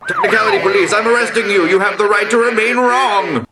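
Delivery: high, loud mock police officer; mock cop voice. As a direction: bust them like a cartoon cop, voice way up. Playing a cop arresting someone.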